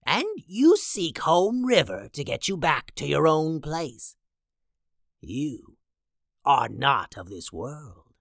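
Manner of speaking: eerie fortune teller voice; soothsayer voice. Acted. Soothsayer character voice.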